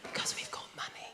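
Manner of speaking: whispered punchline; whispered aside. Shown as a demonstration. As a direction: hush it like a posh secret.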